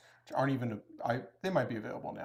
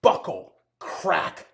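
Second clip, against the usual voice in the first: louder, higher hammed-up fury; mock aggressive rant; 4 semitones higher, 10 dB louder, slower than usual. Imagined reply to a doubting viewer, mock furious rant. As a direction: rant like a biker insulted to the core. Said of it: "kind of aggressive".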